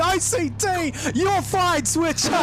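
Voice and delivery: high, urgent race call; live play-by-play. Real, not acted. Commentator calling the third-place battle live, excited play-by-play. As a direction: call every move as it happens.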